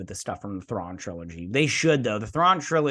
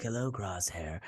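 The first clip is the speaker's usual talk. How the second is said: creepy doctor; 4 semitones lower, 11 dB quieter than usual. Retold or imitated. Doctor greets the prisoner Crosshair, quiet and creepy. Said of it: "This guy creeps me out".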